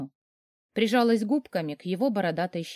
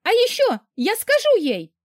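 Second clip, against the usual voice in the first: much higher, louder yell; shouted threat; 13 semitones higher, 5 dB louder than usual. A narrator's reading. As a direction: fling the threat at her mother. Sister shouts back.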